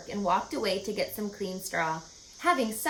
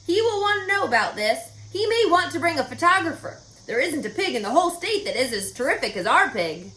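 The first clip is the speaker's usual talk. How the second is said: louder and higher, bursting with pride; thrilled farmer urging his wife to phone; excited farmer; 5 semitones higher, 6 dB louder than usual. A narrator's reading. Proud farmer tells wife to call.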